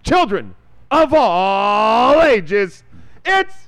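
booming drawn-out showman announcement; ringmaster. Pretend. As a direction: hype up the crowd like a circus host. Announcing the joke session.

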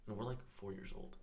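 ordinary talk

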